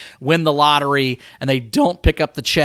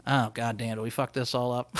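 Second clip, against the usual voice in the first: low, very soft mock realization; quiet dawning regret; 5 semitones lower, 14 dB quieter than usual. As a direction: act out regret under your breath, low. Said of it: "there's that moment where you're like".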